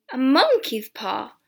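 loud, drawn-out incredulous echo; amused exclamation. A narrator's reading. Son about to laugh at the soldier's paw, incredulous exclamation. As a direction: exclaim it slowly in amused disbelief.

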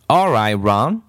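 plain reading voice

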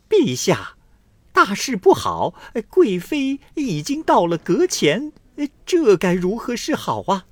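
high, loud frantic cry of alarm; panicked report. Acted. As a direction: blurt it out in a panic. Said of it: "慌慌张张地来报告说". (惊慌失措地高声禀报)

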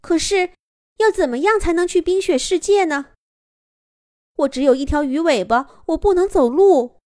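put-on high, worried girl's question; young mermaid asking. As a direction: ask upward, anxious and small. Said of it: "琳达仰着头问道".

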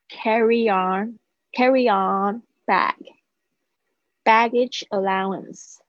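slow, careful vocabulary drill, each term set apart; slow model reading. Shown as a demonstration. Teacher reading the new word list to students, slow and clear. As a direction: read each word slowly and clearly for learners to copy. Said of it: "先来念一次".

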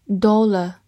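"Dollar" British-style, rounded o and silent r; British accent. Shown as a demonstration. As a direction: give the British DOL-uh for "Dollar". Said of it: "美式发音".

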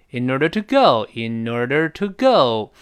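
in order to GO, go drawn out; stress on go. Shown as a demonstration. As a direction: land hard on go and let it drop. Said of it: "在后面那go重读".

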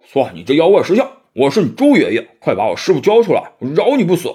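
loud, brash boasting threat; swaggering loud challenge; Pigsy yelling. Acted. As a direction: bellow at the demon to hand over master. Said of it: "猪八戒嚷道".

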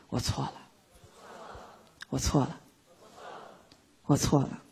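soft, low and sincere, repeated; mean it, quietly, each time; sincere self-admission. Shown as a demonstration. Teacher modeling a sincere whisper to oneself. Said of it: "真心实意地跟自己说".

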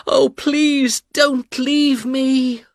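high, slow pleading voice; cling to him, slow and high; pleading cry. A narrator's reading. Wounded Mina begging her husband, slow high plea. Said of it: "she cried".

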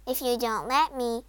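plain reading voice

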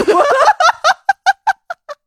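loud, high burst of laughter; falling peal of laughter. Real, not acted. Laughs at blocked nostrils.

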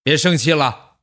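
imitating dad's blunt, high-pitched attempt at comforting; gruff and loud. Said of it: "然后粗气大声地说".

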